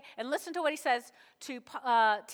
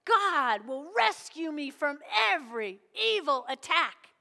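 punched stresses on "rescue", "every", "evil", "attack"; emphatic, swooping declaration of faith; real emphatic. Acted. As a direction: declare it with total conviction, hitting each key word. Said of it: "He's real emphatic".

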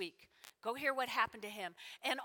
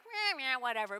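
dismissive meh. Acted. Modern listeners brushing off a Bible detail, dismissive. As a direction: shrug it off. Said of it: "so to us it's like".

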